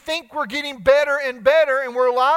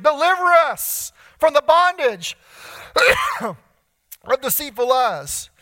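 crying out to God; 5 semitones higher, 4 dB louder, slower than usual. Acted. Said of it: "the psalmist cries out to God".

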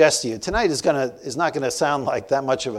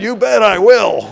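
eager reply; 8 semitones higher, 11 dB louder than usual. Retold or imitated.

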